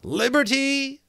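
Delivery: very high, slow thin voice; thin correcting voice. Acted. Sentry correcting the outlaw's password, thin high voice. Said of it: "the thin voice corrected".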